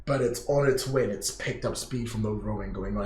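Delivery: ordinary talk, nothing marked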